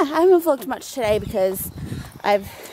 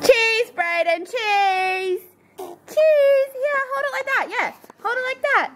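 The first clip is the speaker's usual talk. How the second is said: put-on high baby talk for photo; 11 semitones higher than usual.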